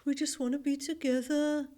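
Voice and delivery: put-on Birmingham accent; Brummy accent. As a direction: do an old advert in broad Brummy. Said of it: "that's my Brummy accent".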